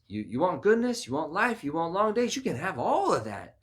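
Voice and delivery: high, loud, rousing promises; emphatic offer. Acted. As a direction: pile up the offers with rising force. Acts God offering life freely.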